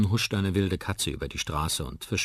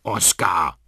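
vorwurfsvoll; 2 semitones higher, 7 dB louder than usual. A narrator's reading. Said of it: "ruft Molly vorwurfsvoll".

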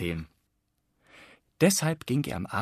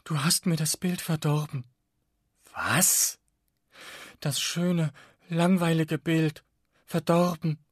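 sniffly reproach; 7 semitones higher, 3 dB louder than usual. A narrator's reading. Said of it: "Die Langeweile schniefte auf".

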